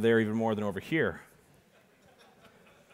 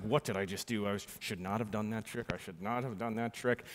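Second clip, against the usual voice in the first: rueful self-talk; 3 semitones lower, 8 dB quieter than usual. Retold or imitated. Inner regret.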